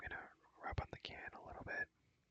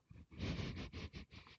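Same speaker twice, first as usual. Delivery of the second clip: long voiceless giggle; whispered laugh; 4 dB quieter than usual. Real, not acted. Player laughing at own dumb joke, whispered giggle. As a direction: giggle helplessly without using the voice.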